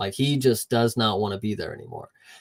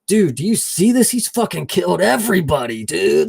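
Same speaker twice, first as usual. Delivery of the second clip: freaked-out buddy; 6 semitones higher, 4 dB louder than usual. Retold or imitated. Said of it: "He's like".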